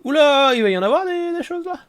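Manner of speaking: very high squealed exclamation; startled wonder. Real, not acted. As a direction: squeal it in high-pitched surprise. Startled by how much is coming.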